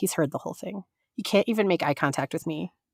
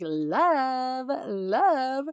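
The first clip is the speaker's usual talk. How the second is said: mock clerk; 5 semitones higher than usual. Clerk repeating love.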